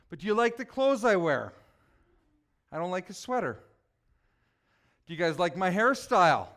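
ordinary talk

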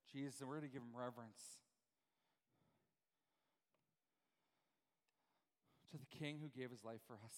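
soft, low, hushed reverence; reverent preaching. Real, not acted. Preacher readying congregation to honor the King, reverent and soft. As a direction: lead them gently into a solemn moment.

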